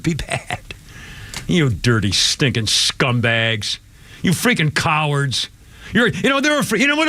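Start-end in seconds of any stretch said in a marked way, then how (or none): 1.5-5.5 low, slow, venomous name-calling; contemptuous insult. Real, not acted. Radio host to prosecutors, slow low contempt. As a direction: drag out the scorn, much lower.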